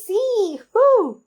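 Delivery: high, loud joyful cheer ending in a whoo; thrilled at keeping the label; elated inner cheer; excited. Retold or imitated. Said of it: "And I thought".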